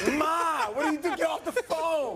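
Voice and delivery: put-on a kid begging mom to hang up; embarrassed son. Son voice pleading with Donna.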